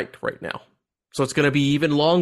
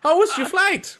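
mock tourist, laughing, voice high; cursing tourist bit with laughs breaking through; 8 semitones higher than usual. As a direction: giggle through the fake German curses.

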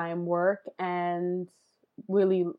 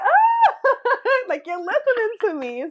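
high, laughing delight; 3 dB louder, slower than usual. Retold or imitated. Answering her brother, high and laughing. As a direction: laugh with joy that he listens. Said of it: "I'm like".

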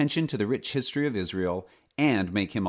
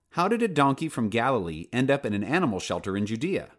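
usual speaking voice

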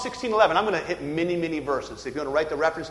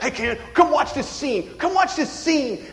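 higher-pitched, eager mock call to a friend; acted excited calling; 7 semitones higher, 4 dB louder than usual. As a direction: call a buddy over, urgently. Voices excitedly calling a friend over.

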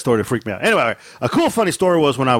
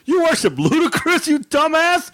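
high, incredulous mock scolding; mock outrage; 13 semitones higher than usual. Dad retelling his reply to his kid's claim, mock outrage. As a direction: scold in a high, disbelieving comic voice. Acted scolding in retold car story.

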